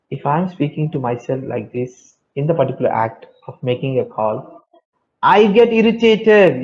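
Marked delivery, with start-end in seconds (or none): 5.2-6.6 mock irritation. Acting out example of irritation.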